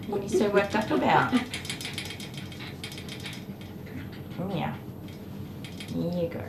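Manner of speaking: hushed, coaxing pet talk; soothing. Real, not acted. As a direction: softly settle a worked-up animal.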